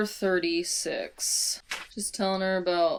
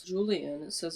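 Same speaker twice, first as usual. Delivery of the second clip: ordinary talk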